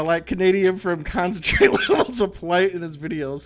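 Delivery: laughing through the words. Real, not acted. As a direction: giggle while you say it. Joking compliment mid-laugh.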